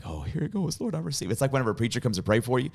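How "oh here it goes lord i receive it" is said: high, soft, expectant prayer; light, high retold reverence; hushed anticipation. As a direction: brace softly for a blessing. Expectant prayer as hand rises.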